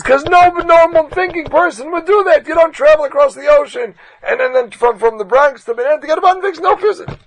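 loud, high exasperated protest; exasperated yell. Retold or imitated. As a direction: yell that it makes no sense. Philip insisting nobody would.

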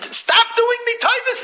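exasperated plea. Retold or imitated. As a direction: beg him to stop, voice jumping up. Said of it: "he says to the guy".

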